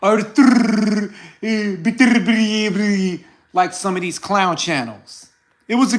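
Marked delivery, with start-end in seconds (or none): 0.0-3.2 lightly hammed Russian accent, slow on Beterbiev; mock the name with a put-on Russian accent; fake Russian accent. Parodying clown channels, mild fake Russian accent. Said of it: "put no fake accent and be like".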